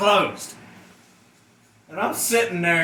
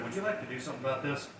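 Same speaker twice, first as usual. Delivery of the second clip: fast, much softer question; quick quiet aside; 6 semitones lower, 15 dB quieter, much faster than usual. Retold or imitated. President asking the guard, fast and hushed. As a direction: toss it off under the breath, quick.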